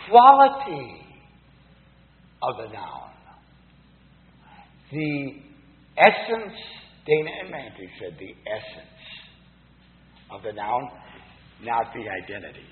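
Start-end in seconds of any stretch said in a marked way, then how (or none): none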